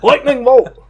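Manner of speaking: loud high shouted spell name; hammed-up yelled spell; mock yelling. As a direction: bellow it like a LARPer casting. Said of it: "somebody yelling".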